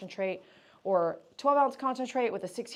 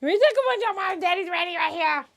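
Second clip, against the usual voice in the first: much higher, louder sing-song cooing; 10 semitones higher, 8 dB louder than usual. Real, not acted. Groomer gushing to the happy dog that daddy arrived, high baby talk.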